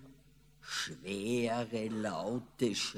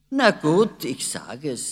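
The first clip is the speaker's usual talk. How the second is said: usual speaking voice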